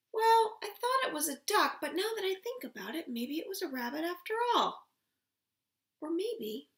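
lively storybook voice, high and loud; reading to a toddler. Acted. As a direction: read it big for little ones. Reads picture book aloud.

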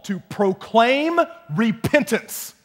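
loud, ringing preacher voice; rising preacher's fervor; full-force preaching. Acted. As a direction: preach it out to the room.

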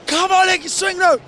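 high-pitched re-enacted fan chant; mock yell urging the crowd to sing; imitated shouting. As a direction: cry it out high, as from the stands.

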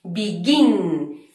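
"Begin" with a drawn-out, stressed GIN; stress on final syllable. Shown as a demonstration. Teacher repeating the example to learners, stress on GIN in "Begin". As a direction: let the second syllable of "Begin" carry the beat. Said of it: "tiene el golpe fuerte en la última sílaba".

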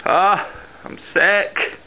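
ordinary talk

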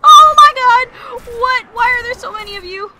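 high, loud panic easing slightly; alarmed yell. Real, not acted. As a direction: shout in shock at being swarmed. Swarmed by enemies.

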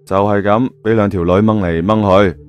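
plain reading voice

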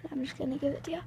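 sneaky hushed whisper. Real, not acted. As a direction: whisper so he can't hear. Whispered aside while sneaking candy.

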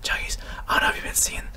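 breathy ASMR whisper, quick. Shown as a demonstration. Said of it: "it's like A S M R".

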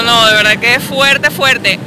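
normal talking voice

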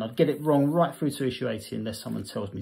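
usual speaking voice

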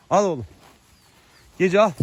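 high, loud eager offer to a horse; coaxing call. Real, not acted. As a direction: coax the horse to take the apple. Coaxing horse to take apple. (yüksek sesle hevesli çağırma)

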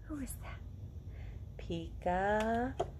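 playful guessing-game read-aloud; reading to children. A narrator's reading. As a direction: ask each guess who with a bright high lift. Reading peekaboo book to children.

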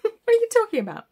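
incredulous. Retold or imitated. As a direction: laugh out your disbelief at her. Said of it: "I just looked at her like".

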